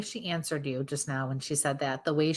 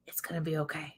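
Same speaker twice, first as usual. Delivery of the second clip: soft reassuring whisper, much softer; 8 dB quieter, faster than usual. Retold or imitated. Medium passing on the mother's comfort in a whisper. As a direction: breathe it out gently. Medium relays spirit's words.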